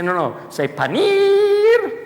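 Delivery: high, slow playful call; drawn-out photographer's prompt; mock photographer. Speaker jokes to audience as photographer, high and slow. Joking camera pose advice.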